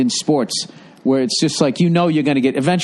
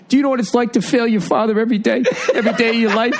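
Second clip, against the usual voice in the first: higher, fast, laughing half-sob; mock crying plea; 6 semitones higher, faster than usual. Italian owner pleading to Gordon, laughing mock sob. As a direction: imitate a big man choking up. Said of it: "his face gets all twisted up".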